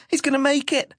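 put-on high-pitched excited child character; excited hope. A narrator's reading. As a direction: pipe up high with hopeful excitement. Child cheers on a friend.

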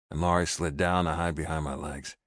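plain reading voice

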